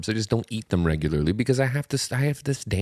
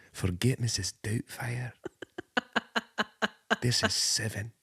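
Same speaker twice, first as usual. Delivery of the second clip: ordinary delivery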